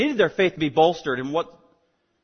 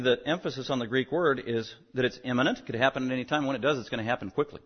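ordinary talk

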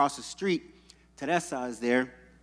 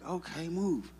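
ordinary delivery

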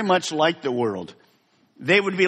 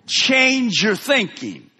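higher and louder, change drawn out slowly; full-force preaching; 7 semitones higher, 4 dB louder than usual. Acted. Preacher urging the congregation to change, full force. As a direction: drive the call home to the congregation.

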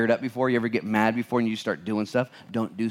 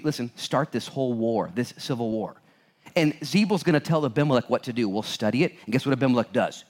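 ordinary delivery

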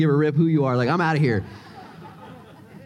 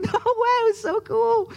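very high-pitched, thrilled exclamation; amazed excitement. Retold or imitated. Preacher recalling a hundred dolphins behind the ferry, high-pitched amazement.

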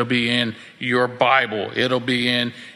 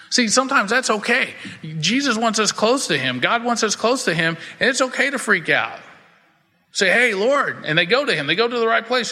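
higher, building preacher's fervor; preaching at full force; 7 semitones higher than usual. Acted. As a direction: lift the congregation with rising pitch. Preaching rises.

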